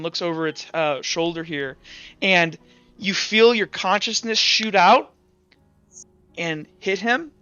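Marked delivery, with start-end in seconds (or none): none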